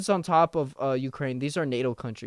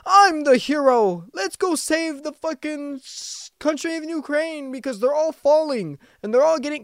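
mock hero; 6 dB louder than usual. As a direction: play a smug cartoon superhero. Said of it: "comes in and is like".

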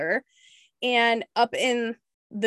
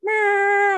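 mock meow; 11 semitones higher, 6 dB louder than usual. Honks back at truck.

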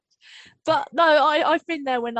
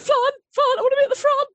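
high, loud, excited imitation; eager boy; 7 dB louder, faster than usual. Imitating Noah begging for the front, excited. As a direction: burst out like an over-keen kid.

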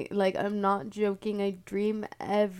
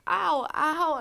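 higher, louder mock yelps; mock pain; 9 semitones higher, 8 dB louder than usual. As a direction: yelp as if hit. Being beaten with club.

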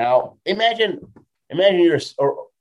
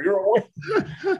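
laughing; 10 semitones higher, slower than usual. Retold or imitated. Repeated winner line, laughing.

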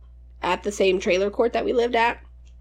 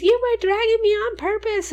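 accusing cousin; 13 semitones higher than usual. Retold or imitated. Injured cousin accusing the narrator.

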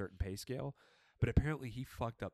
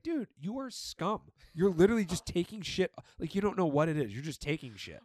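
exasperated rant; 7 semitones higher, 6 dB louder than usual. Retold or imitated. Mocking thief who had no shame.

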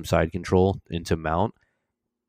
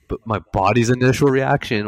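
ordinary talk, nothing marked

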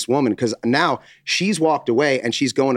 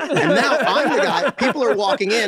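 high, laughing narration; 11 semitones higher than usual. Real, not acted. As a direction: tell it while cracking up. Laughing while telling story.

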